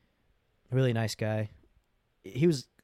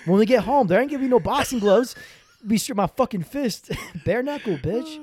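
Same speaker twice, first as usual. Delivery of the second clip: loud, high, hyped-up taunt; mock threatening; 12 semitones higher, 6 dB louder than usual. Brother daring sister to tattle, mock threatening. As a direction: threaten with gleeful exaggeration, raised voice.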